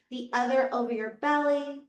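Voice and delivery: ordinary talk, nothing marked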